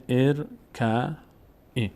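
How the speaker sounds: teacher's spaced-out reading of ار ک ای; kasra on the first vowel. Shown as a demonstration. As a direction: sound out ار ک ای slowly as "er... ka... 'i".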